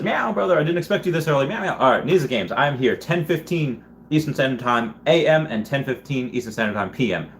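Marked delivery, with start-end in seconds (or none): 0.0-1.8 high-pitched playful meow voice. Acted. Streamer reading a viewer's meow comment, playfully high-pitched. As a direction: read it out high, like a light cat impression.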